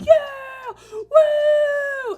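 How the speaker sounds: high, loud thrilled shout; child tearing open a gift, shouting for joy; stoked cheer. Retold or imitated. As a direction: whoop like a kid on Christmas morning. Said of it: "I was so frickin' stoked".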